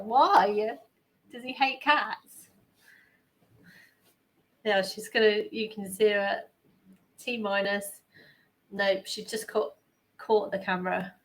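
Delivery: ordinary talk, nothing marked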